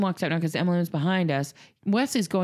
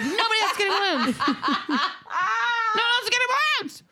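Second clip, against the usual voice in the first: high, laughing half-shout of a frantic mom; laughing panicked yell. Retold or imitated. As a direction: cry it out through laughter, much higher, slowing down. Mother panicking about clown's balloons.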